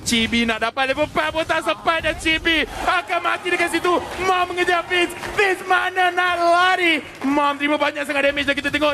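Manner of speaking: rising, building excited shout. Real, not acted. Caster hype on a kill.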